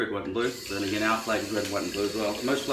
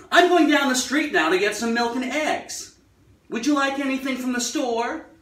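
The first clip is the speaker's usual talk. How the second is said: put-on much higher, louder, crisply enunciated old-Hollywood American; very clear old American; 13 semitones higher, 5 dB louder than usual. Shown as a demonstration. Asking a spouse if they need anything from the store, crisp old-Hollywood American. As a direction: pronounce every consonant like an old film star. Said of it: "Was never like that".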